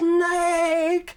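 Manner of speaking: high-pitched panicked shriek, drawn out; scream. Retold or imitated. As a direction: scream a loud, urgent warning in a mother's voice. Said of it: "she screams".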